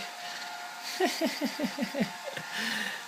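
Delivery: long run of soft chuckles; real laugh. Real, not acted. Parent laughing at the baby.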